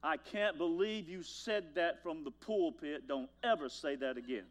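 mother scolds the preacher for what he said from the pulpit; scolding mother. Retold or imitated. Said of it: "here's one you never heard".

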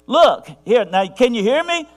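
high, loud, direct holler from heaven; imitating God shouting plainly down; booming voice of God. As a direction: call out loud and urgent, as if hailing someone far off. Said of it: "wish that God talked like this".

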